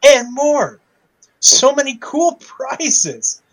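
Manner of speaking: high, slow fake enthusiasm; mock excitement. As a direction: oversell it sarcastically. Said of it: "enough sarcasm in my voice".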